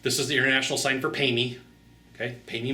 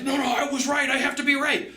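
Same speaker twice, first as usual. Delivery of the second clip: much higher, whiny insistent voice; stubborn salesman; 11 semitones higher than usual. Retold or imitated. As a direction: whine it like you can't let go. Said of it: "the sales guy's like".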